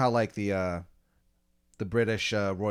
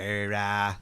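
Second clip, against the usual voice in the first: put-on awful Boston accent; 2 semitones lower than usual. Colonist stalling after a challenge, bad Boston accent. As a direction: fake Boston filler noise. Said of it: "easily the worst Boston accents".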